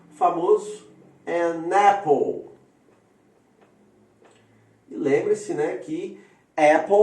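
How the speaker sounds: ordinary delivery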